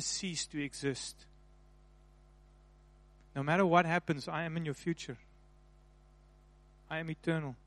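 intimate, reassuring near-whisper; tender voice of God. Acted. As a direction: speak it gently like a loving father to his child. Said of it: "He's saying".

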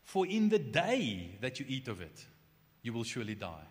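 slow, weighty quote, "For in the day" drawn out; slow deliberate reading. Shown as a demonstration. Preacher slowly quoting God's warning to Adam, deliberate. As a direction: read it slowly to let it sink in. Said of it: "notice what God says here".

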